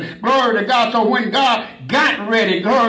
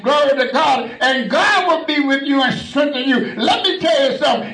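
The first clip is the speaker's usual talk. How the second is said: raised, shouting sermon voice with a higher pitch; fiery preaching; 7 semitones higher than usual. Acted. Preacher rousing the congregation to serve God, shouted preaching. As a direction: preach it out hard to the whole church.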